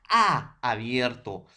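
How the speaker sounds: A drawn out slowly with the mouth wide open; vowel demo, wide open A. Shown as a demonstration. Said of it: "nos vamos a referir a la abertura de la boca".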